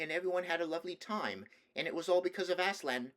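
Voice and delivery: put-on high child voice, running on excitedly; small boy lost in his favourite story; babbling little boy. A narrator's reading. Nephew telling the king of Narnia, babbling little boy. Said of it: "But he babbled on".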